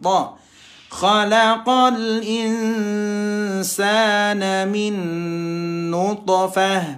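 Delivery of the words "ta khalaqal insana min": normal talking voice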